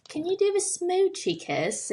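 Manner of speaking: very high Aussie cartoon voice; Australian accent. Shown as a demonstration. Guest repeating the Bluey line, Australian accent. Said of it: "they always say that in the, in the episode".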